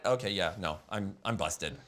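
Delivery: low, soft, resigned mumble; sheepish busted kid. Retold or imitated. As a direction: give up quietly, deflated. Voicing kid admitting defeat.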